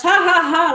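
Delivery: loud, high mock "ha ha ha"; imitated laugh. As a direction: act out someone's loud burst of laughter. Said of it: "and he laughs".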